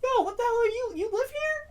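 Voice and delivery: high, hushed startled question; startled disbelief. Retold or imitated. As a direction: ask it in sudden disbelief, voice shooting up. Startled by neighbor being there.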